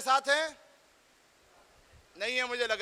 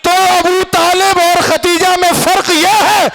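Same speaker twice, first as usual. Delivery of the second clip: rousing congregational oratory; full-force preaching; 6 semitones higher, 21 dB louder than usual. Acted. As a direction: drive up to a roaring, drawn-out climax. Preacher builds to shouted climax.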